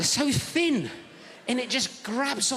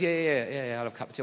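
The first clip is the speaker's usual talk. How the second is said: low builder voice, dropping away; imitating a London builder; South East London geezer; 8 semitones lower, faster than usual. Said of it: "proper South East London geezer".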